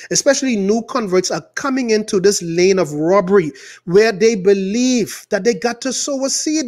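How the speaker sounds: ordinary talk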